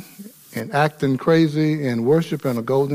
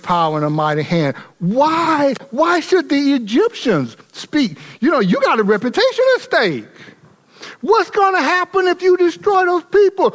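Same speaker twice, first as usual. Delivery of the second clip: preaching at full force; 9 semitones higher than usual. Acted. Pastor urging his church on how to answer anger, full force. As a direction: lift the congregation with rising fire.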